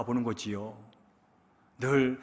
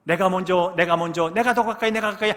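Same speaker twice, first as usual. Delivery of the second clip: jostling crowd; 5 semitones higher, 5 dB louder than usual. Retold or imitated. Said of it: "왁자지껄하면서".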